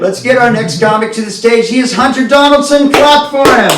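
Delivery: high, building announcer shout; host's introduction. Real, not acted. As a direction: bring on the next act, rising to a shout. Host introduces the next comic.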